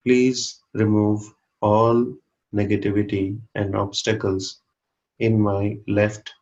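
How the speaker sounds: measured meditation leader reciting an affirmation; guided affirmation. Real, not acted. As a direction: give each phrase room for listeners to repeat inwardly. Leads a silent affirmation.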